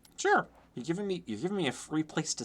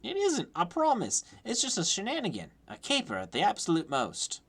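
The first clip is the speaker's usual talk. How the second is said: high-voiced Cockney orphan; Lucky, Cockney; 10 semitones higher than usual. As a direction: protest innocence in a light Cockney accent. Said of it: "I must do Cockney".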